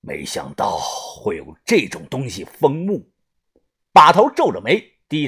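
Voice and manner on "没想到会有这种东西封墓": low, soft voice of a leader; hushed, grave reflection on an obstacle; pondering crew boss. Acted. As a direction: mull it over deep and quiet. Boss ponders the sealed tomb.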